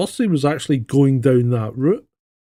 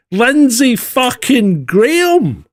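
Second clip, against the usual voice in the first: slow, loud, higher, every word hammered; disbelief; 8 semitones higher, 5 dB louder, much slower than usual. Real, not acted. Host reacting to the news of Graham, slow and loud. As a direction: spit the name out in disbelief.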